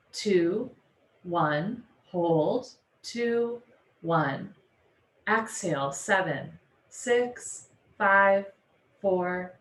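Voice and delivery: slow, evenly spaced counting with long pauses; measured yoga teacher leading breathwork; guided breathing. Real, not acted. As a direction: pace their breath, stretching each cue. Leads counted breathing exercise.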